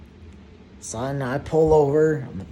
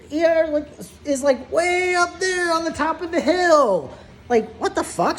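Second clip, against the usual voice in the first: amazed; 11 semitones higher than usual. Real, not acted. Disbelief at ER location.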